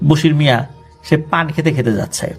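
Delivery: ordinary delivery, nothing marked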